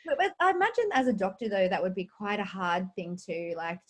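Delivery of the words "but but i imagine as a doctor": ordinary talk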